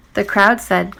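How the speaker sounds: ordinary delivery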